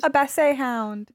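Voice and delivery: "basset" said as bass-AY; Frenchified basset. Retold or imitated. Amused echo to friends, Frenchified "basset".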